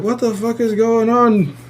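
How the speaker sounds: high, strained-sounding disbelief; retold exasperated bafflement. Dreamer after another dream, retold high exasperated bafflement. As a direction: act out bewilderment with the pitch held high.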